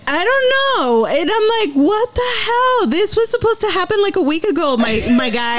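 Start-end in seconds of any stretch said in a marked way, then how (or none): 1.8-5.6 high, mock-exasperated scolding. Wife teasing husband who cries a week late, mock exasperated. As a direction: tease him for crying a week late, pitched up high.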